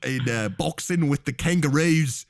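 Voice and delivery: halting, hammed-up bush Australian; bad Australian accent. Telling a bush childhood to co-host, bad Australian accent. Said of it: "can't even do an Australian accent".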